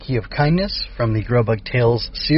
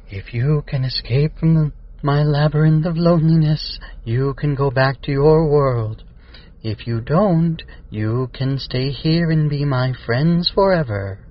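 lonely spooktator; 3 semitones higher than usual. Acted. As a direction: pronounce the challenge like a lonely spook. Spooktator addresses the captive children.

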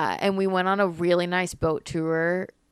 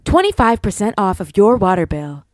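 ad read; 3 semitones higher, 8 dB louder than usual. Acted. Corrected ad line.

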